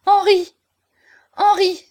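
high, loud cry of a name; heart-rending cry. A narrator's reading. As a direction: cry out for someone lost. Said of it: "Un cri déchirant s'échappa de sa poitrine". (cri déchirant)

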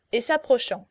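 ordinary delivery

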